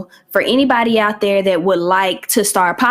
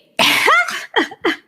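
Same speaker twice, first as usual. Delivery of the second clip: high, bright burst of laughter; real laugh. Real, not acted. Cracking up after bragging she got her edges back, real high laugh. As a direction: laugh at your own joke.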